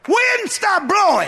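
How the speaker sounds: high, slow, drawn-out command; sudden high-pitched rebuke; rebuking shout. Acted. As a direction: holler the order at the wind. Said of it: "He rebuked the wind".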